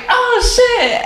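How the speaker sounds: high laughing exclaim. Retold or imitated. Realizing who knocked, laughing. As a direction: squeal it as it dawns on you.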